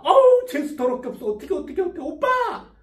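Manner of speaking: mock childish panic, pitch climbing; childlike panic. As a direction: panic like a child calling for her boyfriend. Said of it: "아이처럼 당황한 모습을".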